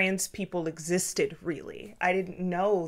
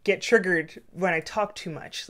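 ordinary delivery

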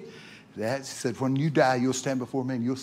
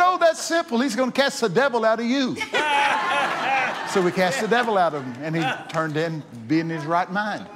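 loud, high, fervent preaching; 7 semitones higher, 5 dB louder than usual. Acted. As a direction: build the sermon to a loud fervent peak. Preaching at full force.

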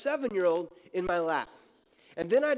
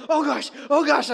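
mock panicked "Oh, gosh, oh, gosh", higher and louder; scared yelp; 7 semitones higher, 8 dB louder than usual. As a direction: yelp it like a frightened dad on a runaway sled. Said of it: "I start kinda making these sorts of sounds".

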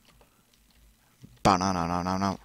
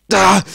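pained yelp; 8 semitones higher, 13 dB louder than usual. Real, not acted. Frustrated gamer dies suddenly.

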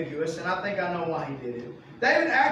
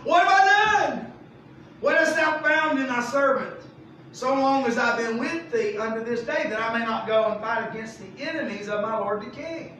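mock innocent protest; 5 semitones higher, 5 dB louder than usual. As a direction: play the wronged, innocent servant. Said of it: "here's what he says on the outside".

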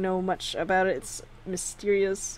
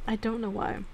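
ordinary talk, nothing marked

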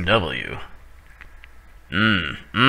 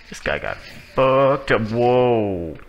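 normal talking voice

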